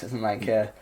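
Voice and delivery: ordinary talk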